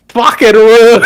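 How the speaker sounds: imitating an Australian driver cursing, Australian accent; mock Australian driver. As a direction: spit it out like an annoyed Aussie driver. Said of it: "and he's like".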